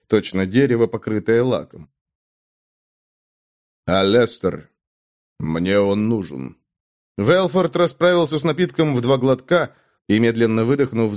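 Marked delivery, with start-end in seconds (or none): 3.9-6.6 low gruff man. Acted. Hero asks innkeeper, low.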